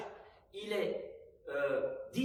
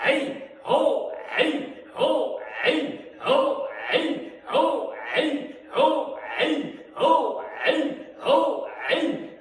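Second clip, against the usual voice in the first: loud high kiai shouts, hey and ho; shouting; 8 semitones higher, 12 dB louder, much slower than usual. Shown as a demonstration. Instructor shouting the hey ho cries with the class. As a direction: bark each cry out with full force. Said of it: "Moi, je vais crier et on verra bien".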